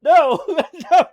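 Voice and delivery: loud laughing protest. Real, not acted. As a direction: laugh it off loudly. Laughing denial to chat.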